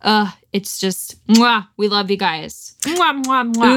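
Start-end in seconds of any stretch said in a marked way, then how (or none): 1.2-3.8 big wet smooch. Real, not acted. Blowing kisses to listeners.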